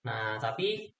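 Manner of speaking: ordinary talk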